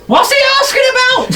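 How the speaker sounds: put-on very high, shouting old lady; Barbara. Gnome wife asks her husband what's going on, shrieked high. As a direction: screech it like a deaf little granny. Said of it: "And then Barbara goes".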